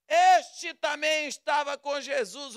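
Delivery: loud, pointed call to the crowd; loud accusation. Acted. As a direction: expose him to everyone. Maid points Peter out to crowd. (a criada aponta Pedro e grita para a multidão)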